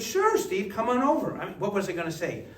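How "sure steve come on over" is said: high, breezy mock welcome; imitating the friendly son; cheerful host. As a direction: toss off an easy invitation. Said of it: "And he said".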